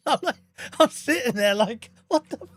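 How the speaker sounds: high, squeaky laughter breaking through the words; can barely get the words out for laughing; laughing in disbelief. Real, not acted. Telling chat about the wife's remark, laughing helplessly. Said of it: "laughing through the story".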